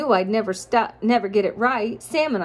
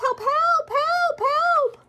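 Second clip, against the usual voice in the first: much higher, panicked dog's yelp; yelping for help; much slower than usual. Acted. The dog sinking in the well yelps for help, high and panicked. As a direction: cry out like a sinking puppy. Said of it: "I yelped".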